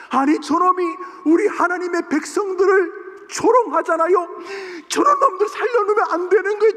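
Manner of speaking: high, loud, furious 저놈이 누구야; outraged shouting. Retold or imitated. Young David shouting at the soldiers, outraged yell. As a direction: yell it out in indignant fury. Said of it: "다윗이 분해서 어쩔 줄을 모르는".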